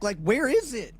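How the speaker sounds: high, incredulous question; baffled. Real, not acted. Baffled by someone's neck.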